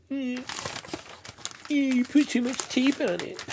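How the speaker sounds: high, loud whiny complainer. Pretend. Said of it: "I can hear the whiny bitch in my voice".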